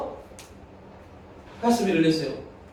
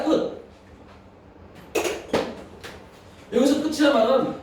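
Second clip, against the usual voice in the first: preaching at full force; 4 semitones higher than usual. Acted. Pastor urging the congregation, impassioned preaching. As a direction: drive home that eternity has no end.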